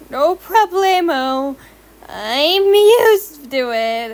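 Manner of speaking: slow, drawn-out cool shrug; nonchalant. A narrator's reading. Brushing it off, cool.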